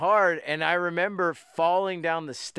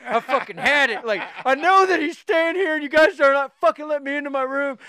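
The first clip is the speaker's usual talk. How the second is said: loud, high-pitched indignant rant; fuming; 8 semitones higher, 5 dB louder than usual. Retold or imitated. Locked-out naked guest to hotel staff, fuming. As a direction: act out an outraged guest at the end of patience. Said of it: "just all fuming, like, angry".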